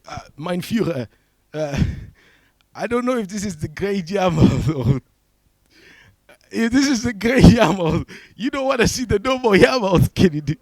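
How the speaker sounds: loud, high German pilot impression; hammed-up German accent, building to a shout; bad German accent. German pilot reporting to his Führer, in a shaky-quality comic German accent. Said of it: "I should work on my German accent".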